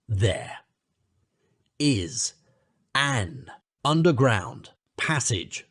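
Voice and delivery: slow, weighty reveal; slow impressive announcement of the secret passage; impressively. Acted. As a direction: dwell on each word, telling a great secret. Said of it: "said the Badger impressively".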